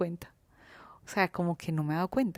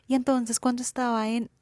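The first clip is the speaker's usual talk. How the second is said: put-on tone; 5 semitones higher, 4 dB louder than usual. Shown as a demonstration. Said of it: "en este tono".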